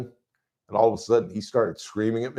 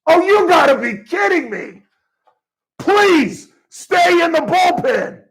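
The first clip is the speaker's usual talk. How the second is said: much higher, loud, stretched-out wail of disbelief; furious fan pleading at the television; exasperated fan shout; 8 dB louder, slower than usual. Shown as a demonstration. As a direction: howl at the reliever like he's ruining the game. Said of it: "I'll just do something like this".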